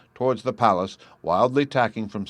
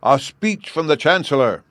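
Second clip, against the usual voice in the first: louder, higher call from the crowd; distant shout; 6 semitones higher, 7 dB louder than usual. A narrator's reading. A voice in the crowd demands a speech, shouted. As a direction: call out from far back. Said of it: "was understood to shout".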